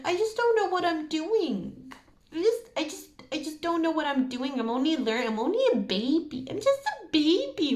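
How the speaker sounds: high, whiny baby voice playing dumb; mock a grown-up pleading they're just a baby; helpless baby voice. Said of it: "play dumb or powerless and they're just like".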